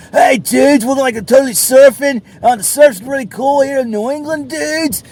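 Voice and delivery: high, loud surfer-dude voice; mock surfer dude hyped about waves; California surfer dude. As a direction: ham up a stoked beach bro. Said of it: "like some crazy California dude".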